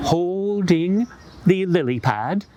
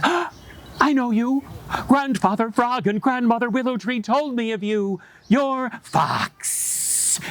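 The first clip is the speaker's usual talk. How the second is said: frightened rabbit; 8 semitones higher than usual. Acted. Rabbit recognizes the fox.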